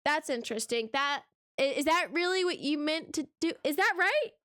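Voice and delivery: rising, increasingly high incredulous questions; mock polite question building to a high, loud "is this right?". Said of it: "when we question anybody about it and we say".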